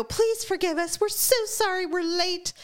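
high-pitched mock plea for forgiveness; loud apology. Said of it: "I walked in very loudly".

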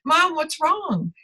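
put-on high, slow child's worried question; lightly voiced child, slow; child asking. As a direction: imitate a concerned kid asking mom. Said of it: "they would go like".

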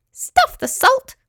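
high, slow squeaky exclamation; tiny crab making up his mind; squeaky little creature. A narrator's reading. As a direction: squeak it defiantly, stretching the words. Squeaky crab's defiant thought.

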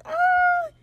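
long high-pitched hesitant "ahh"; mock high noise of weighing it up; high wavering "ah". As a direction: stretch out a squeaky, undecided "ah". Imitates Stede wavering, drawn out high.

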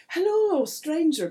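high, loud, showy hello; grand put-on welcome; leprechaun leader's greeting. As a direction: greet the newcomer with a big swooping call. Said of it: "and he says".

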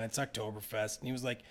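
normal talking voice

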